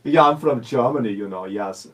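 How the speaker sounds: playful hint of a German accent. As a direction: ham up a German accent for a fan. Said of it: "can you give her some more?".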